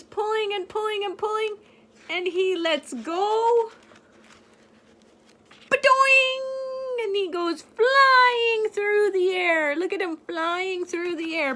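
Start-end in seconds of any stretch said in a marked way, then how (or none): 5.7-9.7 high, loud, cartoon launch cry. Reading to a child, high loud springy ba-doing.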